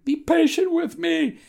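high, pleading plea for mercy; a servant begging his master for mercy. A narrator's reading. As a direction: beg like a man about to lose everything. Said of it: "he begged".

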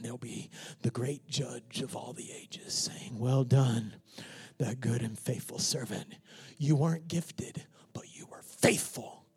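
hushed, unvoiced words of praise; soft reverent whisper; whispered blessing. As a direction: lean in and whisper it close.

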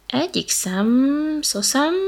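slow, stammering embarrassed snake; a hint of a flustered snake. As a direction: squirm while admitting it. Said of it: "vallotta be zavartan a hüllő".

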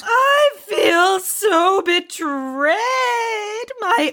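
slow, wailing show of betrayal; melodramatic lament. Acted. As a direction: stretch every word in hammed-up anguish. Melodramatic lament over being betrayed.

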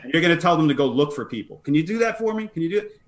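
mock-instructing a third grader, questions pitched high; sarcastic. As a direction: lift into a sing-song "can you do it?" as if to a child. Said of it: "That's a lot of sarcasm".

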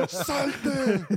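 orden aguda y potente, imitada; grito del coach. Retold or imitated. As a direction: expulsa al jugador con un grito actuado. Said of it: "me empieza a gritar". (imitación de coach fuera de sí)

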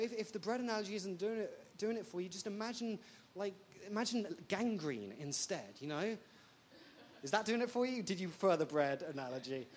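ordinary delivery

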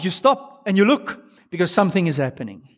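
ordinary talk

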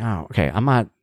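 usual speaking voice

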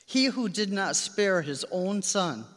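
ordinary delivery

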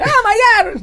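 very high, loud excited squeal; high-pitched, over-the-top exclamation; mock excited. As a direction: imitate a singer's excited high shriek. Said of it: "when he's excited, he can go like".

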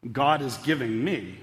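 ordinary talk, nothing marked

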